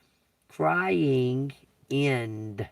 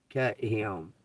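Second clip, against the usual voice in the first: ordinary delivery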